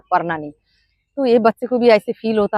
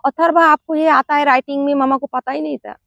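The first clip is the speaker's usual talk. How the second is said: put-on much higher, louder, sugary praise for a child; artificial sweet praise; 7 semitones higher, 4 dB louder than usual. Mother praising Atharva's writing, high sweet put-on praise. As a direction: gush over his writing like it's a big surprise. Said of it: "थोड़ा सा artificial प्यार दिखाना है".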